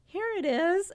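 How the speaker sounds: put-on kid's voice. Kids finally finding the ketchup.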